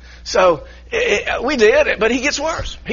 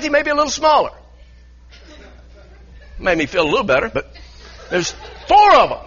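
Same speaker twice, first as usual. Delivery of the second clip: usual speaking voice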